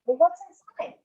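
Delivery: put-on a small girl peeking at the boxes; little girl voice.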